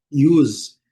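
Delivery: "use" dito iuz, com i inicial; English use. Shown as a demonstration. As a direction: say "use" as yooz. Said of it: "letra S com som de Z". ("use" pronunciado iuz, com som de Z)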